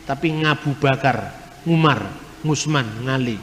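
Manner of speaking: Javanese way of saying the names, "Ngabu Bakar", "Ngusman", "Ngali" with a nasal ng- onset instead of ain; Javanese accent. Shown as a demonstration. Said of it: "Orang Jawa tidak bisa ngomong huruf Ain". (logat Jawa, ain diucapkan "ng": Ngabu Bakar, Ngusman, Ngali)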